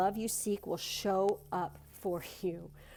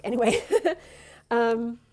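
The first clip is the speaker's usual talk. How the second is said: ordinary delivery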